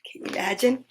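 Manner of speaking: plain reading voice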